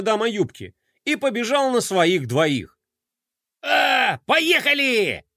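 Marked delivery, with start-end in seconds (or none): 3.6-5.2 high, eager shout to go; excited cry. Acted. Character urging the team forward, excited shout. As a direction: yell it out as they set off.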